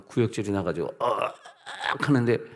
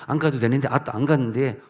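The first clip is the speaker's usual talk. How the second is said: light Jeolla dialect reluctance; a hint of Jeolla dialect protest; 전라도 사투리로 살짝 거절; 3 semitones higher, 4 dB louder than usual. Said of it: "전라도 사투리가 나오다".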